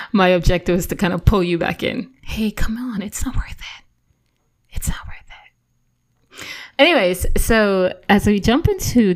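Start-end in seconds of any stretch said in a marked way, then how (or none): 2.2-6.5 hushed, coaxing whisper; soft whisper, slowing down at the end; whispered calming appeal. Acted. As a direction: whisper it to pull someone back from the edge. Replays calming intervention to viewers.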